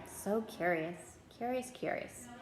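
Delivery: quiet, wondering mutter. Acted. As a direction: murmur it to yourself, lost in thought. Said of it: "still muttering".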